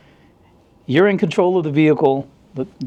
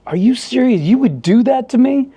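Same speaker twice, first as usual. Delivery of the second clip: high, loud indignant whine; mock outraged coworker; 10 semitones higher, 3 dB louder than usual. Coworker shocked Ed would file theft, high indignant whine. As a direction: act hurt and betrayed. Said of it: "And he's like".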